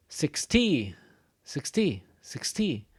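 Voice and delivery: "sixty" strong on "six", falling away; stress on six. Shown as a demonstration. Teacher drilling "sixty" so students hear it fall. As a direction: say "sixty", each time strong then dropping. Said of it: "It goes down".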